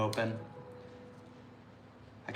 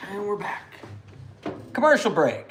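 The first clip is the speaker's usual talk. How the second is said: mock announcer; 7 semitones higher, 6 dB louder than usual. Announcing return from break.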